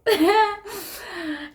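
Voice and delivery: high squealing giggle. Real, not acted. Vlogger giggling happily about her love life, squealing. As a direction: giggle up high, can't stop. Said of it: "I'm laughing because I'm happy".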